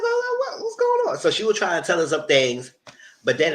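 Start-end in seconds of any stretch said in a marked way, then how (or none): 0.0-1.2 very high-pitched imitated voice; mock puzzlement. She asks what's going on, high mock puzzlement. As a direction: squeak it out as a confused onlooker.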